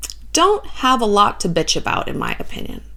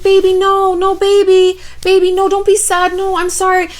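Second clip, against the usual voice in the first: put-on high, sing-song placating baby talk; cooing girlfriend; 9 semitones higher than usual. Paris placating her sulking boyfriend, high pleading coo. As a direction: soothe him in a high mock-girlish coo.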